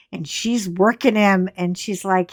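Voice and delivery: ordinary delivery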